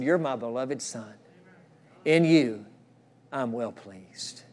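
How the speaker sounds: a hushed, slow I'm well pleased; tender blessing. Acted. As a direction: hand down the blessing gently. Father's words of approval, read.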